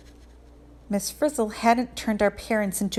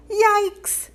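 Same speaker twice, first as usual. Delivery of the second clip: startled yelp; 13 semitones higher, 3 dB louder than usual. A narrator's reading. Sudden fright at owl.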